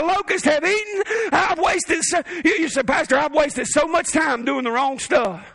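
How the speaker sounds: preaching at full force. Real, not acted. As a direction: preach it out to the whole church, full power.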